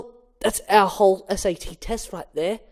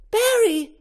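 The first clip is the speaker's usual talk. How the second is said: very high shocked call; alarmed cry; 3 dB louder than usual. Acted. Vanessa cries out as Barry is trapped, a very high alarmed call.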